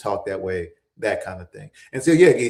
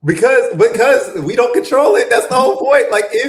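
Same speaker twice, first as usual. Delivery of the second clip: high, raised voice pushing to make the point; loud, insistent interjection; 13 semitones higher, 12 dB louder than usual. Real, not acted. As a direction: cut in loudly and emphatically. Heated talk-over to make her point.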